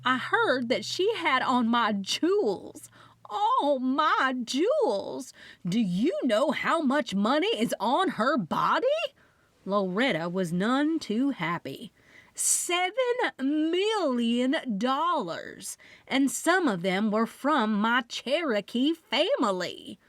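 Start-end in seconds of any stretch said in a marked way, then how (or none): 0.0-8.9 dramatic wail. Acted. 12.4-20.0 high, very slow Southern emphasis; dramatic reveal. Acted. Loretta drawls out the huge sum to the room.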